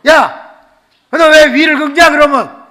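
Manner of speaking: high, very loud retort; exasperated challenge. Retold or imitated. Pastor questioning girl who clawed the wall, loud exasperation. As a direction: yell the question in mock outrage.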